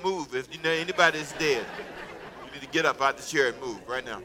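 ordinary delivery

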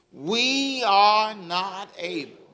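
slow, drawn-out, leading the crowd. Shown as a demonstration. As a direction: draw it out and wait for them. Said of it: "Come on, say".

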